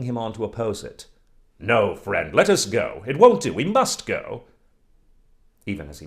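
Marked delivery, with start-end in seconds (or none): 1.6-4.4 loud, stubborn insistence; defiant insistence. Acted. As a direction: push the words out, refusing advice. Chub insists on going out.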